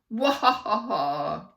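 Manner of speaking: loud, slow mad scientist cackle; evil laugh. A narrator's reading. Principal cackling at his win.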